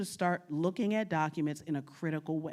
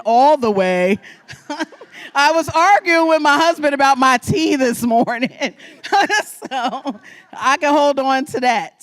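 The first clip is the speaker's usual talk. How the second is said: laughing; 6 semitones higher, 13 dB louder than usual. Real, not acted. Laughing about tea with husband.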